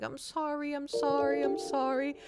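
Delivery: high, tearful repeated sorry; crying. Real, not acted. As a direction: apologise through sobs. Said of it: "just crying".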